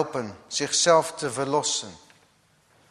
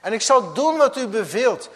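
pleading man; 8 semitones higher, 8 dB louder than usual. Acted. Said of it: "zei de man".

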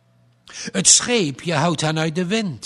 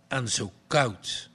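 usual speaking voice

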